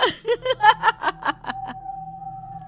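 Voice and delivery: high screeching evil laugh; shrieking witch cackle. Acted. Witch Rohna shrieking with glee, wild cackle.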